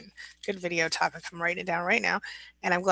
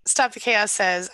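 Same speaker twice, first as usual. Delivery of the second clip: ordinary delivery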